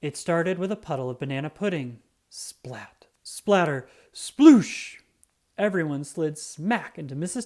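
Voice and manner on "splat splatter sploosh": building splash sounds. Acted. Narrator acting out the pudding slide, whispered then bursting loud.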